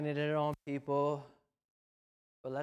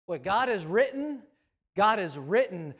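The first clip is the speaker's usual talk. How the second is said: forceful proclamation; 3 semitones higher, 7 dB louder than usual. Acted. Preacher proclaiming God's word to the church, louder. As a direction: ring it out like scripture.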